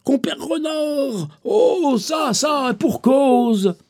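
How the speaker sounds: lightly voiced lion calling; lion calling the fox. A narrator's reading.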